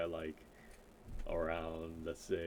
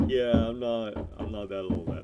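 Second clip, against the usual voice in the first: re-enacted protest, louder and higher; loud protesting retort; 3 semitones higher, 10 dB louder than usual. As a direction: push back loudly, retelling your reply to gamers. Acts out reply to online gamers.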